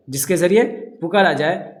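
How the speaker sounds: ordinary delivery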